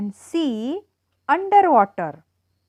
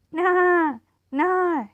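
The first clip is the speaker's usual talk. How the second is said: high, playful "na, na" animal cry; lightly mimicked animal noise; animal call "na na"; 7 semitones higher than usual. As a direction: squeak it out like a little animal. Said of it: "I say".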